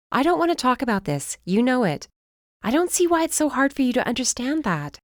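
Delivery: lightly voiced sister, irritated; exasperated sister. A narrator's reading. Darcy voiced by narrator.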